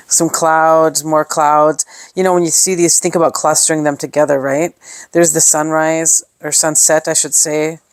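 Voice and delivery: ordinary talk, nothing marked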